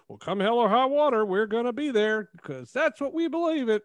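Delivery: mock-defiant Alabama voice; Alabama accent. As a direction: play a defiant Alabaman, drawn up high in pitch. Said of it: "I'm gonna guess that's your Alabama accent there".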